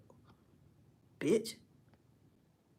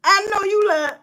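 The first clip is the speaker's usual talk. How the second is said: laughing mock shock; 8 semitones higher, 5 dB louder than usual. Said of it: "you be like".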